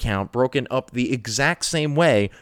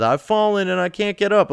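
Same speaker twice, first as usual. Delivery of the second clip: put-on higher old-lady plea; ad quote; 7 semitones higher than usual. Host quoting the old alert ad, higher and mock pleading. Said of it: "what is that".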